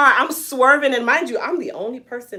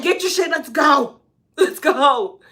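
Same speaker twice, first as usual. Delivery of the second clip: put-on urgent boyfriend order; 4 semitones higher than usual. Imitating boyfriend rushing her out of the restaurant, urgent order.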